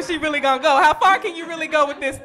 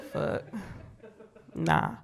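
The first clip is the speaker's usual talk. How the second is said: usual speaking voice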